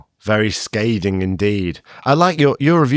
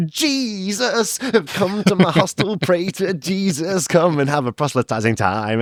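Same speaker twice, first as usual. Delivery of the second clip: mock preacher; 9 semitones higher than usual. Mocking preacher host voice.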